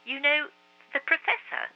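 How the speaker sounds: put-on high young boy asking; boy's voice. A narrator's reading. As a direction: ask it as a boy. Marcus asks.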